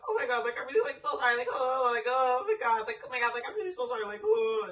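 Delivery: high, whiny mock-tearful sorry; mocking crying apology. Imitating Car tearfully begging forgiveness, high and whiny. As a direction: imitate him blubbering an apology. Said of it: "Car is like".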